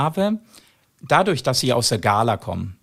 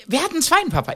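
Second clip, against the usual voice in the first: put-on much higher, bright show voice to children; asking the kids; much faster than usual. Comedian asking the orphan kids on stage, high show voice. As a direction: call out to a room of kids.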